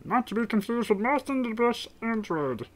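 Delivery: high cartoon alien voice; high-pitched character aside; Marvin the Martian. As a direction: stay high and in character, without pushing volume. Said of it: "Marvin the Martian".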